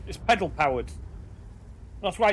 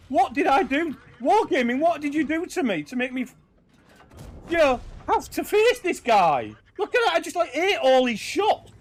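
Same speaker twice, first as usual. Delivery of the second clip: mock whining enemy; 7 semitones higher, 4 dB louder than usual. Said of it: "sat there going".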